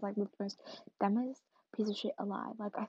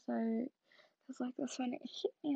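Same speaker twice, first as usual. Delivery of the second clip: ordinary delivery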